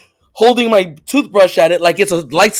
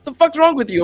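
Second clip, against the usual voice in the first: dad's mock yell, pitched up high; imitating angry dad; 8 semitones higher than usual. Dad bursting into the bathroom, mock angry yell. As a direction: play the startled father barging in. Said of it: "My dad runs into the bathroom".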